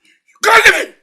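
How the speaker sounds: very loud, high outburst; angry shout. Acted. Father raging in a family quarrel, angry shouting. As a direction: burst out at the top of the voice.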